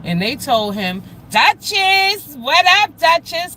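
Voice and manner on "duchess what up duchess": high, loud shout-out; excited greeting. Real, not acted. Streamer greeting a viewer who just joined, excited and loud. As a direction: call out a delighted hello.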